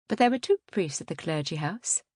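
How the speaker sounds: plain reading voice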